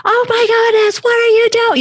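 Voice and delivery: mock hysterical mother; frantic mom. Mom panicking at her kid, hammed-up frantic high cry. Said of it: "It's not like".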